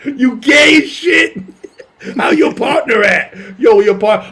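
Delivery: loud, slow mock shout; imagined comedian. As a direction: draw it out loudly, teasing. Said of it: "he would've been like".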